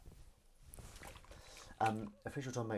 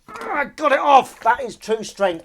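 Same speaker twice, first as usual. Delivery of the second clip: loud, high, drawn-out victory yell; triumphant shout; 18 dB louder, slower than usual. Real, not acted. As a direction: burst out victorious after a struggle. Triumph after forcing it open.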